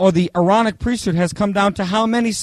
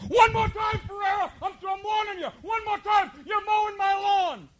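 very high, shouted threat; hammed-up drill sergeant shout; screaming drill instructor; 7 dB quieter than usual. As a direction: get right in his face and yell. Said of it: "he comes out and he goes".

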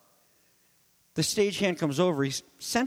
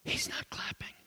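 soft whispered repeat; preacher whispering the line back to the congregation; hushed echo; 12 dB quieter than usual. Real, not acted. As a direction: let it sink in with a whisper.

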